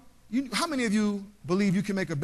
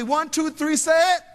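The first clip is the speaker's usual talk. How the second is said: louder and higher, a rally cue to the crowd; calling out; 7 semitones higher, 7 dB louder than usual. Real, not acted. Speaker cues the crowd to shout the answer, loud. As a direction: pump the audience up to answer.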